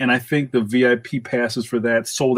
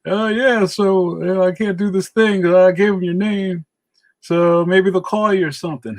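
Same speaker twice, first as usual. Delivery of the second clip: casual phone-message voice pitched well up; put-on higher voice of a helpful colleague; Mike Norton impression; 7 semitones higher than usual. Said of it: "trying to do my Mike Norton voice".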